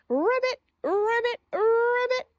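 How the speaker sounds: high, playful ribbit; frog croak. Retold or imitated. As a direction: croak like a cheerful little frog. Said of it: "racha says".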